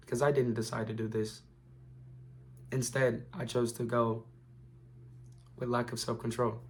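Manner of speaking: quiet low voice. Retold or imitated. Voicing a man's own reflection.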